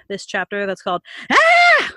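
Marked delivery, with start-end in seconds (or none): 1.3-1.9 sudden high scream; startling shout. Acted. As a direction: yell to make them jump. Sudden jump-scare shout.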